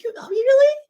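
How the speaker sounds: high, breathless whispered "oh, really"; whispered surprise; surprised whisper. Retold or imitated. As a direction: gasp it out in a whisper, stunned. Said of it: "I'm like".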